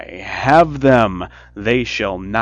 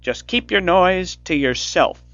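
loud, higher harsh witch; scolding witch; 9 semitones higher, 9 dB louder than usual. A narrator's reading. Witch scolding the weeping girl, loud harsh snap. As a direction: shut the crying girl up. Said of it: "said the old woman".

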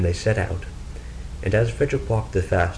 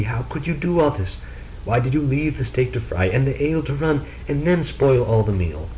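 raised, higher voice, scolding his wife; exasperated scolding; 6 semitones higher than usual. Acted. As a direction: tell her off in disbelief.

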